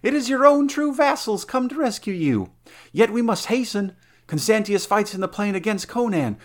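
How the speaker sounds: rally her and hurry her out; urgent rescuer. Acted. Valerius urges rescue.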